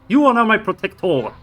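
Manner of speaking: high, loud grand proclamation; loud gung-ho soldier. Pretend. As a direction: declare it like a battle lord. Addresses summoned pet grandly.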